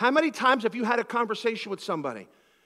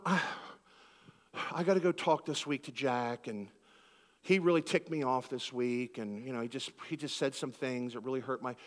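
mock pious; 5 semitones lower, 5 dB quieter than usual. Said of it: "we know how to sound so pious".

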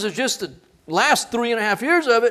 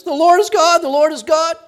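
high, loud repeated proclamation; crying out; 10 semitones higher, 6 dB louder than usual. Acted. Israel on their faces, loud cry. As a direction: call it out from the ground. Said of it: "they're on their faces".

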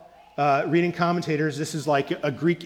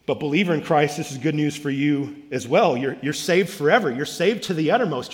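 louder, rising preaching with punched words; full-force preaching; 2 semitones higher, 4 dB louder than usual. Acted. Pastor assuring believers from the pulpit, loud and rising. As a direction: build it up and proclaim it to believers. Climax of sermon appeal.

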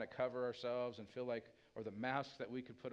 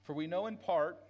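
loud, higher, fervent preaching voice; full-force preaching; 5 semitones higher, 5 dB louder than usual. Acted. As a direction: drive it home to the congregation.

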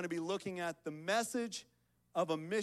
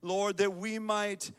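loud, raised-pitch public prayer; fervent prayer; 4 semitones higher, 7 dB louder than usual. Real, not acted. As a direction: call out to God on behalf of the congregation. Leads congregation in prayer.

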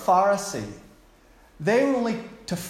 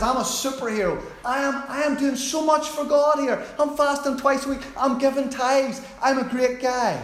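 superhero boast; 7 semitones higher than usual. Acted. Pharisee boasting to God as a superhero, swaggering. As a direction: puff up like a comic-book hero. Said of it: "if believers were in the comic books".

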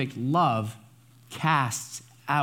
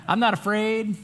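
mock arrogance; 7 semitones higher than usual. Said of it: "It's not that type of arrogance".

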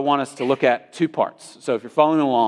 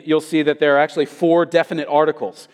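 normal talking voice

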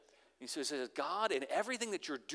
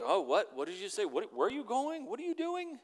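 groggy half-awake muddle; 6 semitones higher than usual. Retold or imitated. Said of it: "He's not drowsy".

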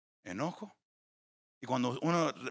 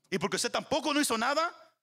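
rising emphasis; 8 semitones higher than usual. Real, not acted. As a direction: voice climbing high, pressing the point. Predicador sube el tono. (predicador subiendo la voz con insistencia)

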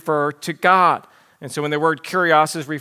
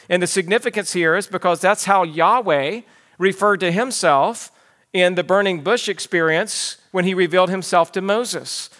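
ordinary delivery

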